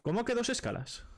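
high, incredulous question with the stress on que; genuinely taken aback, pitching ¿Cómo que way up; surprised. Real, not acted. Friend asks about the layovers in real disbelief. Hearing there were two layovers.

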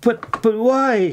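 high, slow stammered shock; taken aback, stretching the question. A narrator's reading. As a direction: stammer it out in high-voiced disbelief. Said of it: "she gasped in shock".

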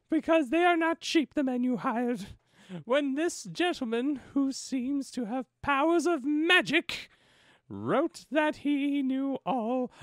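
high, slow put-on lady's voice; high, drawn-out woman's voice reading aloud; woman's confession. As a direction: raise it high and draw it out, ladylike. Plays the woman in the story.

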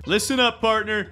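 mock Southern sheriff calling out; hammy Southern cowboy shout; Southern accent. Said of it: "No more southern accents".

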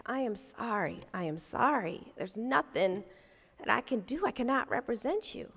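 soft apology. Retold or imitated. Lawyer quietly refusing the upset client, soft and sorry. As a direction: let him down gently, quietly.

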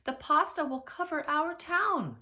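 high, loud cry of doom; alarmed cry. A narrator's reading. Townspeople warning that pasta will bury them, crying out. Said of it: "they cried".

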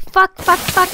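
loud, high panicked curses; frantic cursing under attack; panicked swearing. Real, not acted. Panicking in fight.

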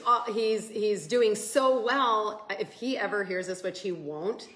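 ordinary talk

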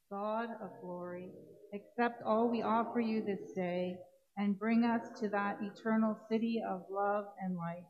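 normal talking voice

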